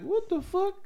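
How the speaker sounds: high-pitched, disbelieving squeak; mock shocked reaction. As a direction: retell your shock in a high voice. Retold shock at first seeing him.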